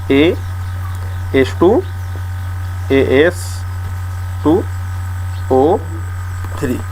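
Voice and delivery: very slow, symbol by symbol with long gaps; drawn-out, piece-by-piece dictation; dictation pace. Real, not acted. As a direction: spell it out slowly as you write. Spelling out formula while writing it.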